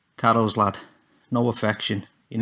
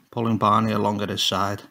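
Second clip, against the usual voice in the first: usual speaking voice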